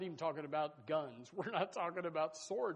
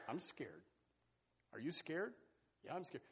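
hushed mock fear; 9 dB quieter than usual. Scared friends whisper their fear to each other, soft. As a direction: say it under the breath, nervous.

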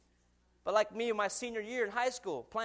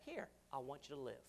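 quiet voice of God; 7 semitones lower, 14 dB quieter than usual. Retold or imitated. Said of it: "God saying".

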